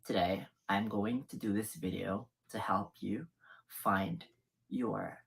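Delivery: the deep voice she warns against. Shown as a demonstration. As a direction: sink right down to a deep masculine pitch. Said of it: "or as deep as this".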